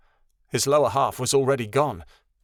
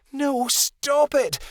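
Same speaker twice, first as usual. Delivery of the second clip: high, slow, desperate yell; 13 semitones higher, much slower than usual. A narrator's reading. Midnight Star yells at the blinding light. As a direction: beg the light to stop. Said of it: "Midnight Star yelled".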